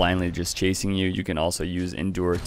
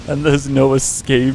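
slow, louder, higher menace; villain gloat; 6 semitones higher, 6 dB louder, slower than usual. Pretend. Cornering the opponent in the tunnel, hammed-up villain threat. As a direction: relish it like a movie villain.

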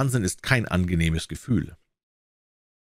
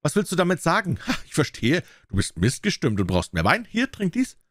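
angry tyrant; 7 semitones higher than usual. A narrator's reading. Tyrant raging at dwarf.

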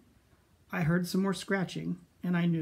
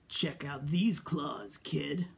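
rasping monster voice; 2 semitones lower, slower than usual. Acted. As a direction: rasp it out from under the bed. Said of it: "a third voice from under the bed rasped".

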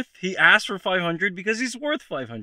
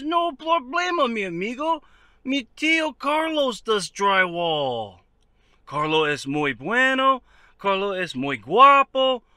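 mock Mexican accent; 2 semitones higher than usual. Voicing amigo recommending uncle Carlos.

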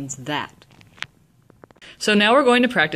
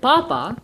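papa with a high stressed first syllable; 5 semitones higher than usual. Shown as a demonstration. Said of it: "emphasis is on the first syllable".

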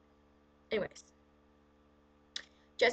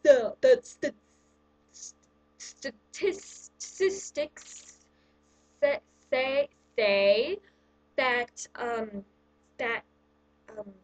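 mock stumbling reader; 4 dB louder, much slower than usual. Mocking a poor reader stumbling.

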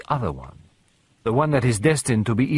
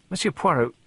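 put-on higher, sputtering young man catching on; flustered young man; 6 semitones higher than usual. Young man realising Poirot fooled them, flustered and higher. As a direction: stammer it out, higher, as the penny drops.

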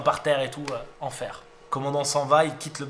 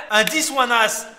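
climbing higher and louder with each call; building from eager to shouting; begging for the card; 11 semitones higher, 7 dB louder than usual. Real, not acted. Streamer begging for the river card, building to a shout.

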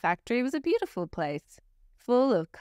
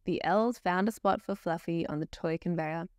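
usual speaking voice

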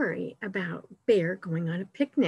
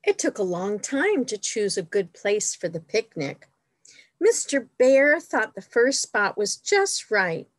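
animated picture-book narration, a bit louder than chat; storytime reading; 4 dB louder than usual. A narrator's reading. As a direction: read it out expressively for little listeners. Reads picture book to children.

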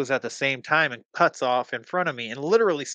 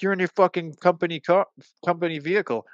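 higher-pitched accusing protest; mimicked taunting driver; 6 semitones higher, faster than usual. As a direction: imitate the other man's raised objection. Other driver accusing, acted quarrel.

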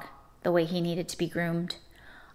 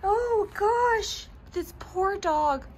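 tearful laugh; 12 semitones higher, much slower than usual. Real, not acted. Laughing pity for the dog.

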